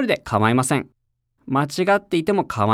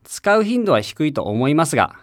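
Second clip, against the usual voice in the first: ordinary talk, nothing marked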